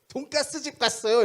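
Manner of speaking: high, loud, guileless chirp; innocently cheerful. Retold or imitated. Young man innocently telling pastor where he went, cheerful. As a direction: blurt it out brightly, proud and clueless. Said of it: "해맑게 웃으면서 이렇게 얘기하는".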